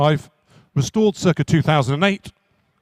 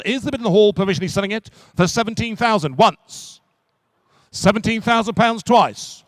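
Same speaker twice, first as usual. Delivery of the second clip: brisk auction-room patter, driving to the hammer; rhythmic auctioneer selling a classic car; auctioneer's call; 4 semitones higher than usual. Acted. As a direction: call the bids to the room and close. Auctioneer closing a lot.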